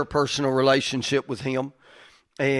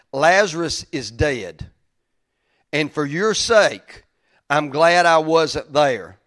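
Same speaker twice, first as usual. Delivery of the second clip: slowed and weighty, each phrase given room; slow emphatic scripture read; 5 dB louder, slower than usual. Shown as a demonstration. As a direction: lean on every word slowly. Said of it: "everybody put weight on this".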